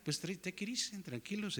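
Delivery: soft and hushed, settling an argument; calm them down softly, almost under breath. Real, not acted. Preacher telling arguing listeners to relax, hushed. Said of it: "calming the arguing crowd".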